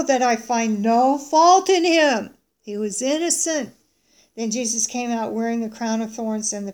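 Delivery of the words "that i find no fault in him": loud, slow proclamation of innocence; emphatic declaration. Acted. Pilate presenting Jesus to the crowd, emphatic declaration. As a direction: declare it forcefully to the whole crowd.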